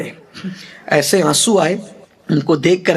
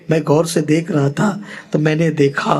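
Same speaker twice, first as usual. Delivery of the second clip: ordinary delivery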